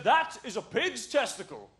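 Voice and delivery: mock English dad, drawn out; southern English dad. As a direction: announce it grandly like a southern English father. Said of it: "the most southern English man".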